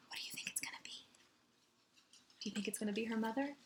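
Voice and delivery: hushed aside. Real, not acted. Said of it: "aside to listeners".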